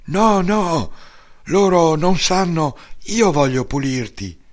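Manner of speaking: a fussing mother who won't take no; mother refusing to let her son go unwashed; mother insisting. Retold or imitated. Mother insists on washing him.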